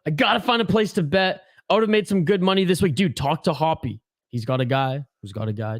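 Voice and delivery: usual speaking voice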